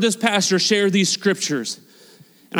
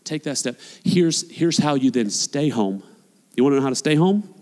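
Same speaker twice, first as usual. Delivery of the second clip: ordinary talk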